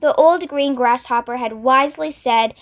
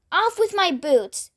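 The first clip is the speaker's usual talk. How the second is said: high, slow urgent call; shouted; 6 semitones higher than usual. A narrator's reading. Centipede at sea, calling out for his boots to come off. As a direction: demand the boots off in a panic. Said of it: "shouted the centipede".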